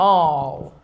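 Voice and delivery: "all" with a long, open vowel. Shown as a demonstration. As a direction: drop the throat and hold "all". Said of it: "C'est un voyelle longue".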